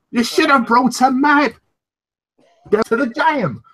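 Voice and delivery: high put-on voice with a laugh; high mock character voice breaking into laughter; quoting a movie line.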